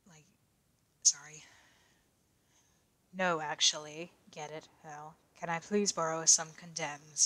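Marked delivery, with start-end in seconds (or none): none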